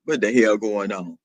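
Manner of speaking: very low deep register. Acted. Asking the chat what is going on, deep voice. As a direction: ask it from way down low. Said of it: "when this boys leave me".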